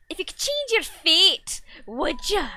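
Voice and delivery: big dramatic Scottish movie line; Scottish accent. Pretend. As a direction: belt it out in a hammed-up Scottish accent. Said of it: "quote ever in the Scottish accent".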